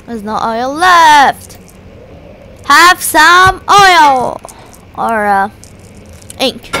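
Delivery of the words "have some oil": slow, higher, louder put-on voice; mock villain offer. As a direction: hand it over like a gleeful bully. Throws oil, teasing.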